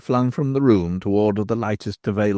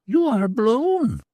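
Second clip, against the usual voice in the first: much higher, cackling crone voice; a knowing old beldam; old hag's voice; 13 semitones higher, much slower than usual. Acted. Hag tells him.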